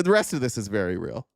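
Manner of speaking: ordinary talk, nothing marked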